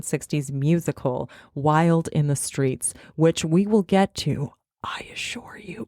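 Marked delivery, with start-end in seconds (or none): 4.8-5.9 whispered aside. Acted.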